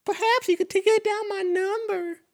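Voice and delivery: very high, put-on falsetto; cartoonish high female voice; falsetto woman. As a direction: play a girl turning down a date in squeaky falsetto. Same voiced woman offers her number.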